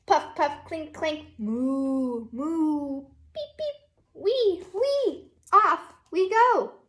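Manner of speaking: teacher reading Down by the Barn to her class, sing-song; reading to children. Acted. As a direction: read it like a storybook for little kids. Reads picture book aloud.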